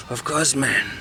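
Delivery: lightly voiced stoner; a hint of smoke held in; stoned smoker. Smoker answers in sauna.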